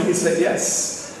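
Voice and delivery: ordinary talk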